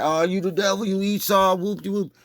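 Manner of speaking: high, mocking yelling-preacher voice; mocking street preacher. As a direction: parody a self-righteous corner yeller. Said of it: "You like".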